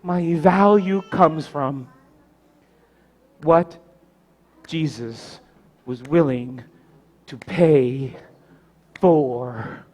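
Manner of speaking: slow, low, word by word with pauses; preach, solemn. Real, not acted. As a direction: let each word land softly. Hushed climax of sermon.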